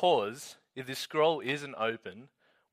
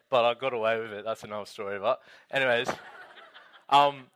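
normal talking voice

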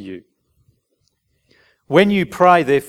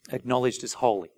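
Acknowledged as holy said softer, gently falling; soft emphatic restatement; softer repeat; 3 semitones lower, 8 dB quieter than usual. Shown as a demonstration. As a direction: quietly repeat Acknowledged as holy to let it land. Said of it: "That's what hallowed means".

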